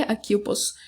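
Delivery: ordinary delivery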